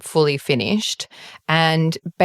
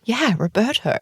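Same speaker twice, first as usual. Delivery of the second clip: higher-pitched "Yeah", as if it's obvious; prompting confirmation; 6 semitones higher than usual. Retold or imitated. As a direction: repeat ROBERTO to jog their memory. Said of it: "she just looks at me completely blankly".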